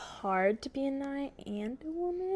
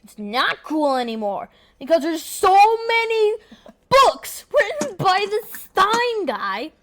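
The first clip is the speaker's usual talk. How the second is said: high, loud, climbing exasperation; exasperated rant; 6 semitones higher, 4 dB louder than usual. Real, not acted. Library aide complaining about so many books, exasperated.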